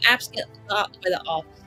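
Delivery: usual speaking voice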